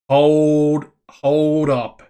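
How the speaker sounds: real stunned double take; startled halt. Real, not acted. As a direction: stretch it out, stopped in your tracks. Stunned by sudden job opening.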